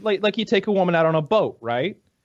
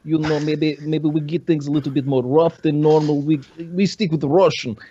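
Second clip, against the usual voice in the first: put-on Russian accent; thick comic Russian voice. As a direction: suggestive offer in a mock Russian accent. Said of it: "in your Russian, like, voice".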